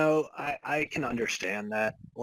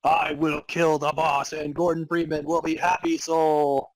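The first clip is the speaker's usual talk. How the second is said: higher, louder yelled vow, hammed up; mock sad yell; 5 semitones higher, 5 dB louder than usual. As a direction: vow it loudly through mock grief. Voices John Freeman yelling.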